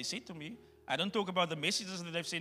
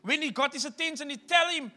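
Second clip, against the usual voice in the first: raised, loud preacher's voice; preaching at full force; 9 semitones higher, 9 dB louder than usual. Acted. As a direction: drive the point home to the congregation.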